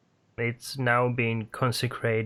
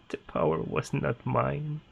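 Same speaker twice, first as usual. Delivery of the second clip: unhinged prisoner; 4 semitones higher than usual. Acted. Prisoner speaks through door slit.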